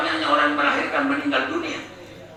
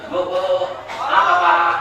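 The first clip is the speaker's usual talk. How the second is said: wife answering loud; loud call and reply across the house; 3 semitones lower, 5 dB louder than usual. Acted. Husband calls his wife, she answers loudly.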